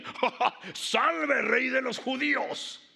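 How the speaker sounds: risotada falsa y saludo a gritos, muy agudo; grito burlón. Acted. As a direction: vocea una reverencia de burla, alargándola. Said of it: "burlándose le gritaban". (soldado burlándose a gritos)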